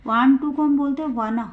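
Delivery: usual speaking voice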